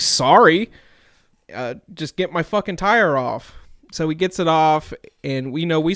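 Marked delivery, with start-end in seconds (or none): 0.0-0.7 louder, curt angry apology; pissed-off sorry. Retold or imitated. Stranded driver snaps sorry at the tow guy, louder and angry. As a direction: bark the apology through anger. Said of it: "I was just pissed off".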